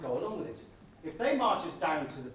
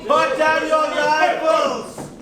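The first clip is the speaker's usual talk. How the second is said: shouted orders; 9 semitones higher, 12 dB louder than usual. Acted. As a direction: bark commands at the troops. Barking orders at the men.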